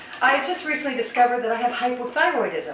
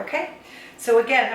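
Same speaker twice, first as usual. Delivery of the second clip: ordinary talk, nothing marked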